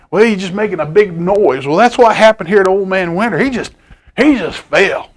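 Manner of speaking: plain reading voice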